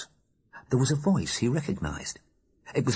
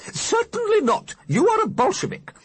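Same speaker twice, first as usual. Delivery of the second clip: put-on indignant old lady ghost; 6 dB louder than usual. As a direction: huff at him, utterly outraged. Mrs Liberty scolds the Alderman.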